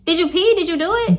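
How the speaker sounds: high, pressing questions; anxious friend. Retold or imitated. Friend pressing about the sample, high and anxious. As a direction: demand an answer through the door.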